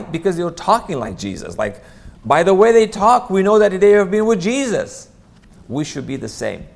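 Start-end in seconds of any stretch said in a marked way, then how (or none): 2.2-5.1 loud and much higher, driving it home; raised voice proclaiming how the disciples spoke; impassioned preaching. Real, not acted. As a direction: preach it with full force. Preaching climax about Jesus.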